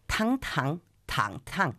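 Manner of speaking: "tang" repeated, each on a different Chinese tone; four Chinese tones. Shown as a demonstration. Student quotes her Chinese teacher's diet rules, "tang" in changing tones. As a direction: chant "tang" through level, rising, dipping, falling tones. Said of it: "son cuatro tonos".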